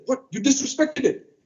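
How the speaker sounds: high, shocked scolding; upset auntie. Retold or imitated. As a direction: scold as the offended aunt. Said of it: "she got really upset".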